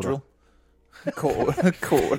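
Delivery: amused Kiwi accent, r dropped; New Zealand accent. Shown as a demonstration. Friend practices one word for the host, New Zealand accent. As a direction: laugh while trying the Kiwi accent. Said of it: "you could totally be a Kiwi".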